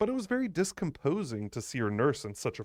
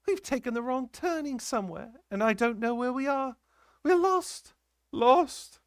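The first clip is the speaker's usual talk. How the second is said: high, rising into loud panic; the nurse realises they're lost; terrified; 13 semitones higher, 3 dB louder than usual. A narrator's reading. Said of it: "The terror she was in".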